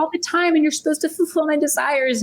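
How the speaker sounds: high, fast mock wail; wife protesting through mock tears; mock crying whine. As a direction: replay her teary protest as a whine. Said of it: "I was, like, crying".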